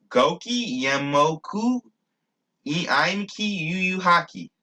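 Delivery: slow, high-pitched reciting of a phrase; careful recitation. Shown as a demonstration. Speaker recites a phrase in his made-up language, slow and careful. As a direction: pronounce each foreign-sounding word slowly and deliberately.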